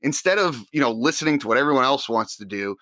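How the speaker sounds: normal talking voice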